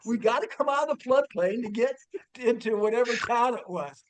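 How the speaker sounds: usual speaking voice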